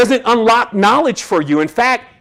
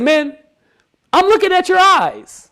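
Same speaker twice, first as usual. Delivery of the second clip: imitating the old doctor; 12 semitones higher than usual. Retold doctor Theo's verdict.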